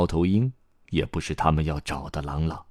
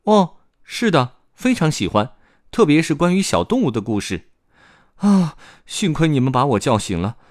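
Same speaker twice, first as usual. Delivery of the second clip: put-on raised child voice; eager little boy; 5 semitones higher, 3 dB louder than usual. Boy answers story grandpa, eager high child voice. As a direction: chatter in a higher young boy's voice.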